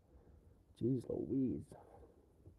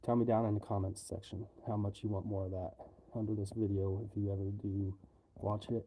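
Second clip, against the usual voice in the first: ordinary talk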